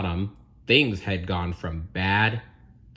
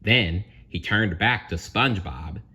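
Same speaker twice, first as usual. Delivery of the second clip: plain reading voice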